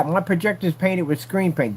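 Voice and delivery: ordinary talk, nothing marked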